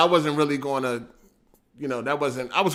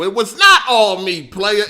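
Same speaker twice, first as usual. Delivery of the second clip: ordinary talk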